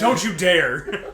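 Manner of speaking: loud, slow laughing protest; laughing outburst. Acted. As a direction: cut in with a laughing shout. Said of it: "Kakyoin quickly interjected".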